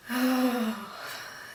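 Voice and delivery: long voiced sigh of relief. Shown as a demonstration. Reviewer relieved about her main worry, long relieved sigh. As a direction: let out a drawn-out "oh" of relief. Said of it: "Sigh of relief".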